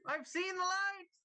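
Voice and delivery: high, ecstatic cries of revelation; ecstatic cry. Acted. Caliban overacting his fake collapse, crying out high. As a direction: cry out at seeing the lights, way up high. Dramatic fake death throes.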